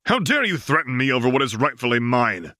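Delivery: claiming what he thinks is his; outraged yell. A narrator's reading. Slave master defending his claim, heated outrage. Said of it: "the master yelled again".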